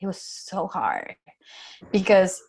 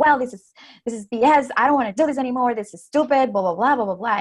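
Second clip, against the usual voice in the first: frustrated venting; 5 semitones higher, 4 dB louder, faster than usual. Retold or imitated. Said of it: "when I was frustrated".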